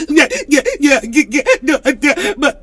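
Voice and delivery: high, giggly string of yeahs; laughing yeahs. Real, not acted. Laughing along with chat, giddy yeahs. Laughing agreement after gasp.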